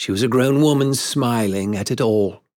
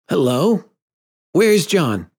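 put-on boy's abrupt, higher-pitched query; abrupt, puzzled boyish question; sudden question; 8 semitones higher, 4 dB louder than usual. A narrator's reading. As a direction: ask it suddenly, voice lifting. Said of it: "he asked suddenly".

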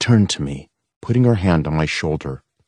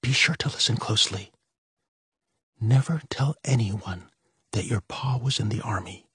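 hushed and urgent; mother warning her children in a hush; tense hush; 7 semitones higher, 6 dB quieter than usual. A narrator's reading. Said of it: "her voice on edge".

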